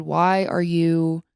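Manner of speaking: usual speaking voice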